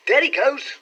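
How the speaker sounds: high, loud exclamation; excited cry. A narrator's reading. Clerk watching his employer slip indoors, excited cry. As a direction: call it out as he vanishes. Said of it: "cried Hall Pycroft".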